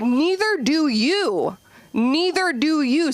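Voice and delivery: high, slow teasing comeback; mock retort. Teacher teasing the kids back, high and slow. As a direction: fire it back at the kids, drawn out. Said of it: "I'm like".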